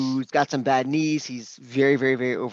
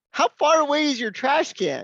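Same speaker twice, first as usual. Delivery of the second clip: much higher, disbelieving question; incredulous question; 13 semitones higher, 3 dB louder than usual. Retold or imitated. Doctor questioning the patient, high incredulous mock tone. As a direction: ask it in comic disbelief.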